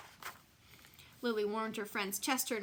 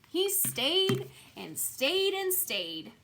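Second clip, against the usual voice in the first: slow and high, each stayed dragged further; drawn out, even higher; 8 semitones higher, 4 dB louder, much slower than usual. A narrator's reading. Narrator dragging out the endless stay, slow and high. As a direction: stretch it out wearily.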